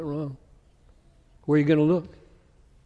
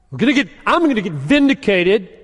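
higher and louder, gloating claim; mock triumphant; 7 semitones higher, 8 dB louder than usual. Preacher mocking a gloating self-righteous believer, loud triumph. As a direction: crow it like you won.

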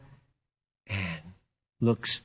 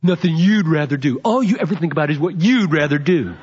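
louder, a mock-scolding wife turning "you'd" against him; indignant wife's retort; 5 semitones higher, 5 dB louder than usual. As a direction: throw "you'd" back at him with mock reproach. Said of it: "would she ever say".

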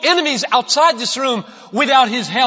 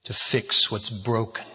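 prayer; 12 semitones lower, 6 dB quieter than usual. Acted. Pastor praying softly to God for the congregation, low and hushed. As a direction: pray quietly to God before preaching.

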